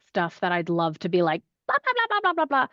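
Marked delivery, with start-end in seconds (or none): 1.7-2.7 sing-song high blah blah blah; dismissive high-pitched blah chatter; breezy mocking blah blah. Mock filler chatter.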